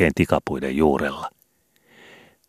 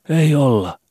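put-on a much higher, firm young boy's denial; assuring boy; 11 semitones higher, 4 dB louder, slower than usual. Skobe denies breaking the window, firmly as a boy. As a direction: deny it flatly like a boy with nothing to hide. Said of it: "vakuutti Skobe silmiä räpäyttämättä".

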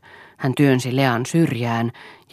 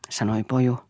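usual speaking voice

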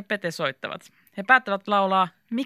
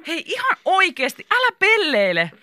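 exasperated; 11 semitones higher, 4 dB louder than usual. A narrator's reading. Krista tells Mika off.

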